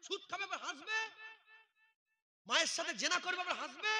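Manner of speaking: high, loud indignant demand; angry shout. Real, not acted. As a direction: challenge them with outrage. Scolding audience for laughing.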